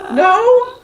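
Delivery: high, loud retold "no"; mock indignant denial. Wife answering husband's bigamy question, mock indignant. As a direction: blurt it out high in playful protest.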